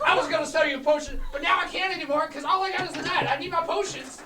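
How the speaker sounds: hammed-up grievance about losing everything; mock rant. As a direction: potion seller complains to the party, loud and hammed-up. Seller rants about loss.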